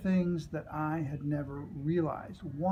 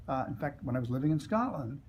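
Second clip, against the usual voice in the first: usual speaking voice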